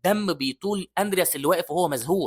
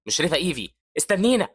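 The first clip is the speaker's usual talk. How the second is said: calling out; 3 semitones higher than usual. Acted. Hazar calling after Evie running ahead, shouted plea.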